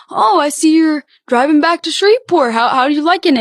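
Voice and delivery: lightly voiced cheery state trooper; a hint of an overly chatty officer; chatty cop. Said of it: "And he was like".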